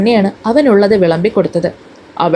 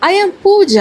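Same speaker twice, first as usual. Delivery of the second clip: haughty correction; 9 semitones higher, much slower than usual. Acted. Teen insists on her name.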